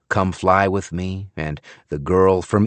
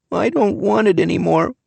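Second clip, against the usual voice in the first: put-on soft child's voice; 12 semitones higher than usual. A narrator's reading. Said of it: "In a very soft voice".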